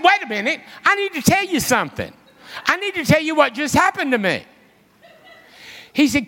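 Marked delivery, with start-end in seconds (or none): 0.0-4.4 high, hurried insistence; upset plea, pitched up and pushing to be heard. Retold or imitated. Upset caller urging Chuck to wait and listen, insistent. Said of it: "I'm upset".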